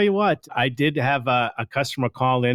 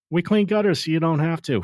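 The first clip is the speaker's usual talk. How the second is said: catchphrase delivered higher, like a radio ad; ad tagline voice; 6 semitones higher than usual. Shown as a demonstration. Host quoting the company's radio ad, upbeat. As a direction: pitch it up like the jingle line. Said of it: "catchphrases that are out there".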